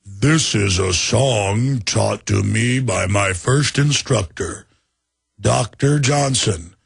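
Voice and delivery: slow down like a machine shutting off; dying HAL. Retold or imitated. Host voices HAL fading as it's shut down. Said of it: "as he's going down to the lower functions".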